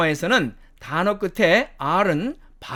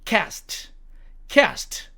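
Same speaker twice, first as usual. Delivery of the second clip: American "cast", front a as in "cat"; American demo of "cast"; American accent; 3 semitones higher, 3 dB quieter than usual. Shown as a demonstration. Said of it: "미국식 발음으로는".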